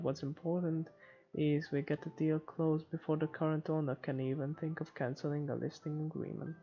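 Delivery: ordinary talk, nothing marked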